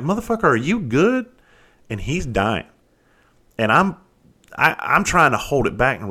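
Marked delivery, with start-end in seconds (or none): 0.0-1.3 high-pitched half-laughing call to his partner; laughing disbelief. Retold or imitated. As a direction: blurt it out, barely holding the laugh in. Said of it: "I look over and I'm like".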